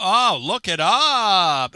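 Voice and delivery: loud, slow, drawn-out teacher impression; high, swooping, stretched catchphrase; shop teacher's catchphrase. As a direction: holler it like an exasperated shop teacher. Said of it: "as Dave Sander used to tell us".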